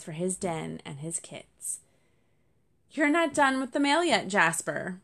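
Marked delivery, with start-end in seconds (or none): 2.9-5.0 higher and louder call from above. Acted. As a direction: bird calling down while swooping overhead, louder and higher. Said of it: "Beckett Featherly called as he swooped overhead".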